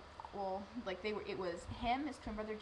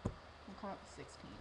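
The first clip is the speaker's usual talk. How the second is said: secret whisper; hushed teasing reveal; 9 dB quieter than usual. Retold or imitated. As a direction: whisper it like a surprise. Surprise reveal on Skype.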